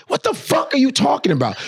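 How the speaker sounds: normal talking voice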